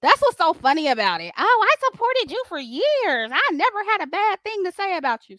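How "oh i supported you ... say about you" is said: high, whiny mock protest; mocking high-pitched quote; mock complaint. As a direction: imitate a wounded supporter, pitched way up.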